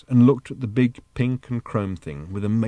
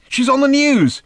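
high, loud excited call; shouting; 7 dB louder than usual. Acted. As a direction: call out urgently to the next room. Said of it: "shouted Arthur from the next room".

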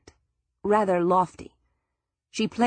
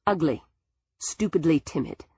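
ordinary delivery

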